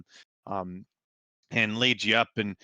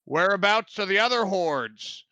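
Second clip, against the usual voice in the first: raised, higher voice called downward; calling down from above; 8 semitones higher, slower than usual. Acted. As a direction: call it down from the wall. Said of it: "he calls down".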